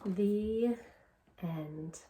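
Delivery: put-on reading the last page to kids; story reading. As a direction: bring the picture book to its close. Story read-aloud ending.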